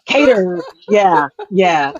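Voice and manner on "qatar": Qatar said as KAY-ter; long "ay" in the first syllable of Qatar; variant pronunciation. Shown as a demonstration. Host listing how some people say it, Qatar as KAY-ter. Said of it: "some people are like".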